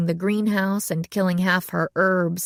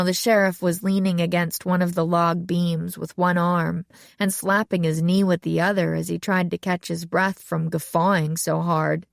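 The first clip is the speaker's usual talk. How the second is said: ordinary delivery, nothing marked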